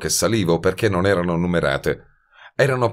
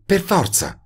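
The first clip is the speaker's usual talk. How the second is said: higher, drowsy woman's voice; sleepy; 13 semitones higher than usual. A narrator's reading. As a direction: say it half asleep. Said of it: "disse assonnata". (assonnata, voce femminile più acuta)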